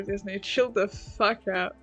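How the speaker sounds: usual speaking voice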